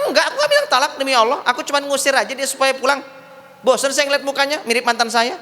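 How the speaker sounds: high-pitched, insistent comic protest; protesting husband. Acted. Husband swearing he only sent her away, protesting.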